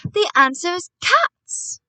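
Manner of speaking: loud, high, drawn-out announcement; triumphant shout. A narrator's reading. Boy revealing his plan to grandmother, triumphant shout. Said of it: "I shouted".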